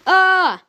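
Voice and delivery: sharp, loud high scream of shock; a sudden piercing "Ah!". A narrator's reading. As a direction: scream as the cats land on you. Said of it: "they screamed".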